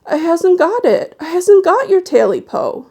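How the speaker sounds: high, loud, panicked plea; the frightened man denies having the tail, high and loud; terrified denial. Acted. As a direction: squeal it out in terror. Terrified man answers the creature.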